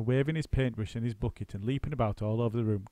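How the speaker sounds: ordinary delivery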